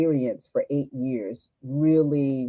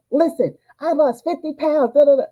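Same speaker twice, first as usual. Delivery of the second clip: proud bragging; 14 semitones higher, 7 dB louder than usual. Retold or imitated. Bragging to doctor about weight loss.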